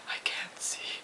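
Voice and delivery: pitiful whispered complaint. Retold or imitated. She tells her boss why she wears sunglasses, whispered. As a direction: breathe it out helplessly.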